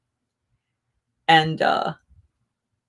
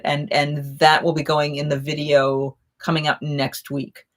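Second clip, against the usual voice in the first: ordinary talk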